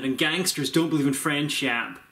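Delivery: ordinary delivery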